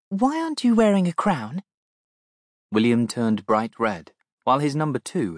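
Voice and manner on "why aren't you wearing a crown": put-on high, curious child; a small girl asking innocently; little girl voice. Little girl asks the Princess.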